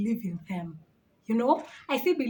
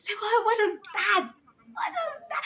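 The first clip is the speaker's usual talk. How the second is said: incredulous outburst; 10 semitones higher than usual. Real, not acted. Incredulous at blood myth.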